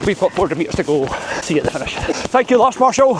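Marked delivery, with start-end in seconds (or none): 1.9-3.1 high, loud call across the path; calling out thanks. Real, not acted. Thanking the marshal while running past, loud high call. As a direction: shout your thanks over to him.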